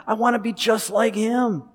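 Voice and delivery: a son who idolizes his father, lightly voiced; admiring son. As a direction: look up to your dad with wide eyes. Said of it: "That's how Jesus thinks of his dad".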